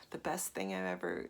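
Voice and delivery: ordinary talk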